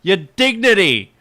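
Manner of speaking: loud, drawn-out retold yell; yelling at the screen. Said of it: "I yelled at my screen".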